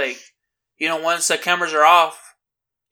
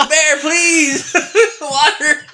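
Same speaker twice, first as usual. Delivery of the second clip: high, laughing mock fish plea; fish begging; 8 semitones higher, much slower than usual. Voicing the suffocating fish pleading with Bear, high and laughing. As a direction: beg Bear for water, giggling.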